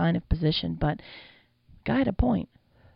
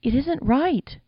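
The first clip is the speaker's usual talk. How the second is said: high, stammering plea; whimpering; 9 semitones higher, 4 dB louder than usual. A narrator's reading. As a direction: plead in a whimper, pitched up. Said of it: "Jack whimpered".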